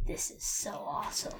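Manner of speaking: hushed, savoring whisper; gloating whisper. Real, not acted. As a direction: whisper it in private delight. Player privately relishes the chaos.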